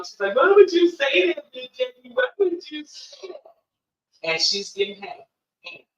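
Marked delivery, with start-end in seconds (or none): 0.3-3.5 high-pitched, raised-voice imitation of an upset girl; acted-out hurt outburst, pitched up; indignant protest. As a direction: throw the question back, affronted. Client's upset protest being acted out.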